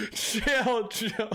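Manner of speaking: laughing so hard it nearly sounds like crying; laughing plea. Real, not acted. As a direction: gasp it out while cracking up. Laughing fit after joke.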